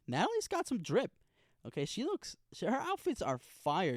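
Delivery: high, loud excited praise; hyped gushing. Real, not acted. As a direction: gush about her style. Gushing over a character's outfit.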